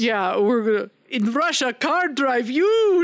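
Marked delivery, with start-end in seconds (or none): 1.1-3.0 higher, slow hammed-up Russian accent; cartoon Russian accent. Podcaster mocking an actress's accent, cartoon Russian accent. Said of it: "just a cartoon accent".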